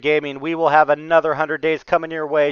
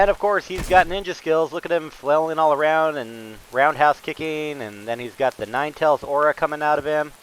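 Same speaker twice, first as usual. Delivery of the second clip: normal talking voice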